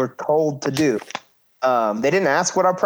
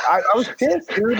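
pitched up, a touch of real heat; heated retelling; 8 semitones higher than usual. Real, not acted. Telling a friend about losing it in the ring, heated.

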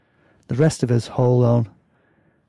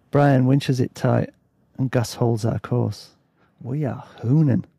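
usual speaking voice

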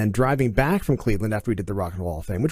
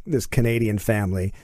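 ordinary talk, nothing marked

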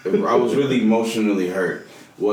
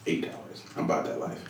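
salty; 6 semitones lower, 12 dB quieter than usual. Real, not acted. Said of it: "You sound so salty".

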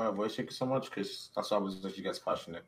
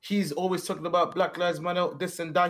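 mocking quote; 7 semitones higher than usual. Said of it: "People were saying".

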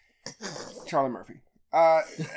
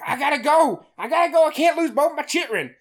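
much higher, louder mock panic; panicked; 8 semitones higher, 5 dB louder than usual. Father abandoning his kids, hammed-up panic much higher. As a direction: blurt it like he's fleeing in a hurry. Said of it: "So the daddy was like".

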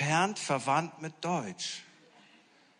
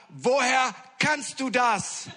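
slow, high, louder astonished question; 9 semitones higher, 7 dB louder, slower than usual. Retold or imitated. Pastor stops the prayer and asks the teenager, slow and astonished. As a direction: stop short and ask in disbelief.

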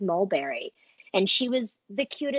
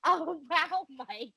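slow, louder, over-the-top flattery; hammed-up adoring praise; mock gushing; 6 semitones higher, 6 dB louder, slower than usual. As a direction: gush like reading a forced compliment. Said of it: "he wrote for me to say".

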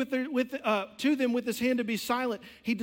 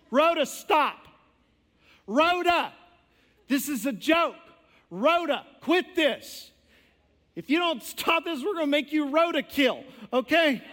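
loud, high exasperated scolding; mock scolding; 6 semitones higher, 6 dB louder than usual. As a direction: tell her off, half joking. Believers scolding Rhoda.

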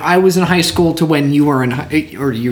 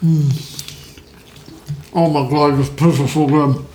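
chewing while talking. Real, not acted. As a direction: mouth full of pizza. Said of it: "I'm chewing".

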